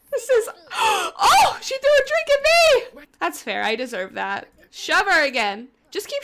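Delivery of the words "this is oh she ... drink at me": gasping, high and loud, outraged; scandalised squeal at the game; shocked gasp. Real, not acted. Shocked reaction to thrown drink.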